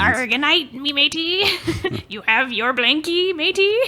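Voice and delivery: high, hammy pirate voice; pirate captain. Pretend. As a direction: ham up a pirate saying goodnight. Pirate captain tucking sailors in.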